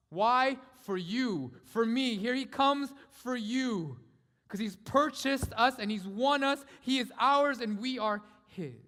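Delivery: loud, high, slow proclamation; impassioned preaching. Acted. Preacher tells the church they are won, impassioned. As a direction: drive each phrase home at full force.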